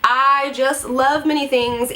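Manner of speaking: normal talking voice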